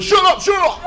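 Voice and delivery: high, frantic "shut it" cry; panicked mock yell. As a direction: yelp it like someone freaking out. Freaked-out quote of someone yelling.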